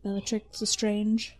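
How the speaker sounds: a light echo of the mad witch; understated mock Bellatrix; Bellatrix impression. Naming Bellatrix in her voice.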